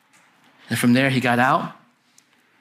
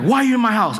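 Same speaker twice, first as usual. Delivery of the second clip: higher-pitched mild mock yell; retold scream; 7 semitones higher, faster than usual. Preacher retelling his friend confronting strangers in their house, mock scream. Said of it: "And he's screaming".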